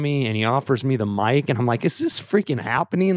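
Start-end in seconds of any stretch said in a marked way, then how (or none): 1.8-3.2 high-pitched retold astonishment; amazed disbelief. Dad handed the mic by his favorite singer, amazed disbelief. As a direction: marvel at it, pitch lifted. Own amazed thought acted out.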